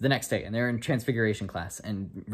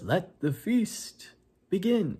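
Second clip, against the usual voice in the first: higher, very slow, grand proclamation; grand announcement; 9 semitones higher, much slower than usual. Retold or imitated. Headmaster opens the feast for the hall, slow and grand. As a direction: draw it out like opening a great feast.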